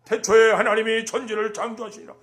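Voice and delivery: booming public scripture reading; loud reading aloud. Shown as a demonstration. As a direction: proclaim it loudly like scripture read to the crowd. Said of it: "소리 높여 읽는 거예요".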